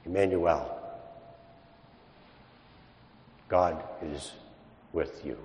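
soft, slow and reverent; hushed reverent. Real, not acted. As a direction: let it settle softly, with awe. Reverent pause after questions.

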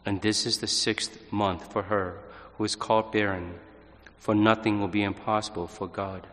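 measured, solemn scripture reading; gospel reading. Acted. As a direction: proclaim the Gospel calmly and steadily. Priest proclaims the Gospel reading.

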